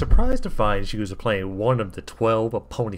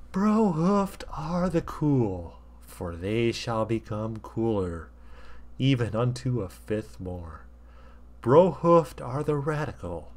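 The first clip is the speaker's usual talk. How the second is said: slow, grand sermon voice; solemn preacher. Acted. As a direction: intone like a prophet on temple steps. Stallion preaching lecture.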